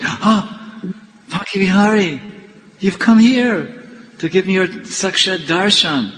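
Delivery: breathless gasp into high, amazed cries; awed ecstasy. Retold or imitated. Devotee suddenly seeing his Lord come to him, awed ecstasy. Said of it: "And he collapsed in, in ecstasy".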